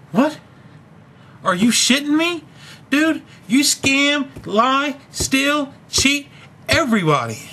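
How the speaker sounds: real disbelief and anger at the boss; indignant outburst. Real, not acted. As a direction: spit out every word like a charge sheet. Ranting at absent boss.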